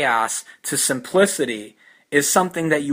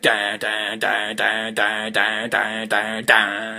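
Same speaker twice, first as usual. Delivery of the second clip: "da" repeated as low, even, equally stressed beats; monotone chant of "da", no weak beats; every syllable stressed; 5 semitones lower, slower than usual. Shown as a demonstration. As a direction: pound out each "da" with the same weight. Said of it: "ten stressed syllables in a row".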